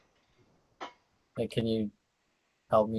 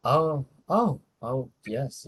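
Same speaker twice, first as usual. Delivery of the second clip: sudden high gasp of recognition; oh, oh, it dawns on him; surprised realization; 7 semitones higher, 5 dB louder, slower than usual. Acted. In character seeing reflection.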